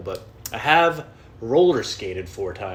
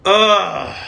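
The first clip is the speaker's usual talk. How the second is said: high groan; 8 semitones higher, 10 dB louder than usual. Real, not acted. Disgusted groan at boring podcasts.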